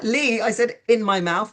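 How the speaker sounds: high-pitched, comic telling-off; exasperated protest. Retold or imitated. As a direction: correct him in mock exasperation. Retold protest to water thrower.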